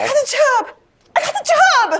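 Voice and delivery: high, loud squeal of triumph; ecstatic. Acted. Applicant celebrates with friends on being hired, ecstatic. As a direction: shriek it out, overjoyed.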